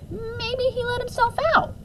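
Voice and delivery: a dog answering back. Acted. Dog answering the mother in a strange voice. Dog's line, odd voice.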